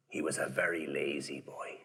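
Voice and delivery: hushed, secretive whisper; a half-joking whisper to the kids; whispered aside. A narrator's reading. Confiding to the children.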